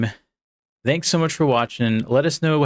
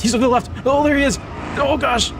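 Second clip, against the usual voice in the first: being chased. Real, not acted. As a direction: yelp in sudden alarm. Being chased by another driver.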